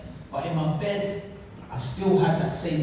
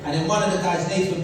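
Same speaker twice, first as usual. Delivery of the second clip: usual speaking voice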